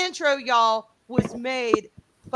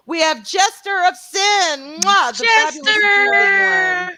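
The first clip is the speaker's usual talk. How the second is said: high, loud fan greeting; gushing welcome; 8 semitones higher, 9 dB louder than usual. Real, not acted. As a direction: shout a fond hello. Loud welcome to chat member.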